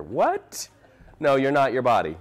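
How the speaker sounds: high-pitched mock "what?"; surprised reaction. Teacher mimicking a friend's reaction at the back, surprised. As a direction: imitate a startled listener's reaction. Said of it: "I love the reaction I got".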